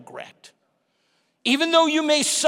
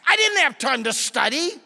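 ordinary talk, nothing marked

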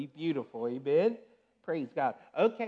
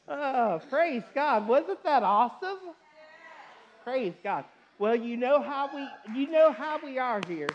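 excited; 6 semitones higher, 5 dB louder than usual. Real, not acted. Pastor thrilled after proposal.

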